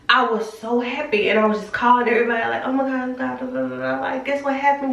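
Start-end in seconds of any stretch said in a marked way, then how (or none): 2.6-4.9 higher, thrilled retelling of the calls; excited retelling. Retold or imitated. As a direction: gush like you're sharing big news. Retold excited phone calls.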